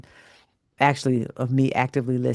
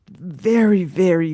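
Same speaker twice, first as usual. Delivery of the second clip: first very pitched much higher and louder; emphatic very; 5 semitones higher, 6 dB louder than usual. Real, not acted. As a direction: lean hard into very, then ease off. Building emphasis on how small.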